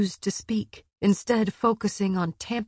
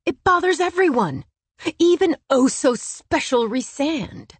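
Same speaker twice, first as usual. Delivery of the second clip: loud, high-pitched snide outburst; scornful, raised-voice jab; 7 semitones higher, 6 dB louder than usual. A narrator's reading. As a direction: spit it out with heated sarcasm. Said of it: "that snide fucking tone".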